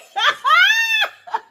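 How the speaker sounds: high, loud shriek of laughter; howling with delight. Real, not acted. Laughs at the quote.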